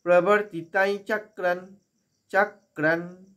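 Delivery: "চক্রান" intoned with a held final n; measured "প্রবর্তিতাই চক্রান", closing on n; n ending. Shown as a demonstration. Teacher drilling the phrase, "চক্রান" ending in long n. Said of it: "ন হয়ে গেল".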